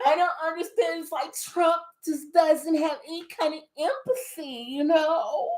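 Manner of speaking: mocking Kamala. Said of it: "drunk ass Kamala's talking about".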